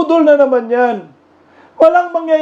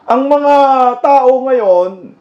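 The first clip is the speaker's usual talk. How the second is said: normal talking voice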